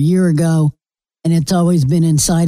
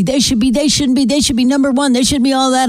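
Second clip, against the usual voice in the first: much higher, indignant mock rant; mocking upset hosts; 7 semitones higher than usual. Mimicking sports hosts going berserk, higher mock rant. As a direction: whine like a furious homer fan.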